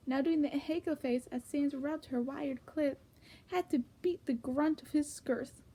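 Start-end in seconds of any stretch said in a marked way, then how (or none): none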